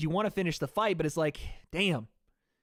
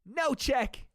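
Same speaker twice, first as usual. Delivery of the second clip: high, clipped Irish brogue; a streamer doing Conor's Irish accent; mock Irish Conor quote; 8 semitones higher than usual. Streamer quoting Conor, light Irish accent.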